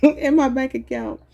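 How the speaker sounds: slow read through real laughter; laughing. Real, not acted. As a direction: try to read while cracking up. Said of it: "I'm sorry for laughing".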